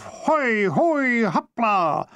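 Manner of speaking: high whooping shout of effort; hammed-up athlete's battle cry; triumphant shout. A narrator's reading. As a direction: launch it like a leap. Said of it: "with a triumphant shout of".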